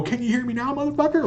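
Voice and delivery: high, squeezed mock-angry challenge; half-volume imitation of an angry yell; imitating Rob. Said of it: "Like that".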